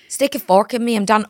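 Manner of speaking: loud Australian attempt that comes out Irish; botched Australian accent, sounding Irish; accidental Irish accent. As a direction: belt it out in an Irish lilt. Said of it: "No, no, no, that's Irish".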